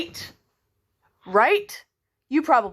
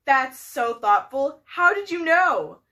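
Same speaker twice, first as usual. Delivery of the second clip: usual speaking voice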